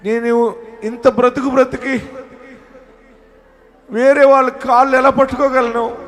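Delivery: high lament building to loud wail; imitated anguished cry. Preacher voicing a believer too ashamed to beg, anguished wail. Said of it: "అని ఏడుస్తున్నావా?".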